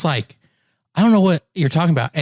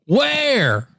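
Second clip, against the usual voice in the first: mimicking the bartender; 10 semitones higher than usual. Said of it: "And he just goes".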